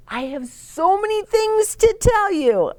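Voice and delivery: put-on high, eager little-boy voice; bursting to share news; child's excited greeting.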